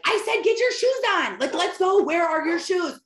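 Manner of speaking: high, loud exasperated mom shouting; mock yell at child. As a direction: bark orders at a dawdling toddler. Mom yelling at child, late.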